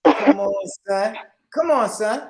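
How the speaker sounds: loud, put-on New York accent; hammed-up New York accent. Shown as a demonstration. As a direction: call it out like a fake New Yorker. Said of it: "Do a New York accent".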